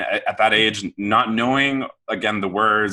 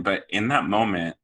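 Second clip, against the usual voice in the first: ordinary delivery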